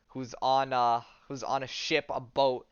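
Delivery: ordinary delivery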